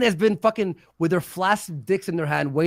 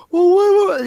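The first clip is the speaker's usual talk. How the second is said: high, loud whiny complaint; 12 semitones higher, 9 dB louder than usual. Retold or imitated. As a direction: whine it like a sulking man. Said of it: "like, that whiny voice".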